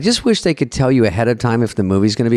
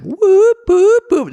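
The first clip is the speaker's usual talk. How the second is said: high, loud alarm-like whoops; panicked inner whoop; 4 dB louder, much slower than usual. Retold or imitated. Panicking on set, inner alarm whoops loudly. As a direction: sound the alarm in your head. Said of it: "inside my head, I'm like".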